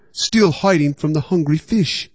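plain reading voice

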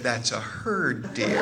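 slow, patronizing correction; condescending patience. Retold or imitated. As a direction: spell it out slowly to a child who got it wrong. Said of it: "That tone, again".

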